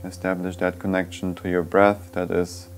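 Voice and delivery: soft meditation voice with long, unhurried pauses; guided meditation. Real, not acted. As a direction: guide them gently inward, leaving silence between phrases.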